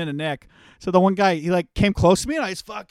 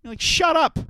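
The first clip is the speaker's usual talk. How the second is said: mock angry retort; 6 semitones higher than usual. Acting quarrel with tall guy.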